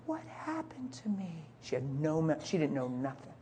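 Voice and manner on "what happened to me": slow, high whisper; small, hushed, higher voice; bewildered wife. Retold or imitated. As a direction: imitate a just-woken patient asking softly. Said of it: "And she said".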